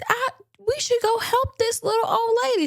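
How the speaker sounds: high, innocent-sounding suggestion; good Samaritan act. Acted. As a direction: pitch it up like a helpful do-gooder. Suspect acts out Samaritan concern.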